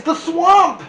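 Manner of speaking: high, loud alarmed shout; frightened cry. A narrator's reading. Kids shrieking as the strict teacher arrives. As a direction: cry out the name in terror. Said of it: "cried the kids".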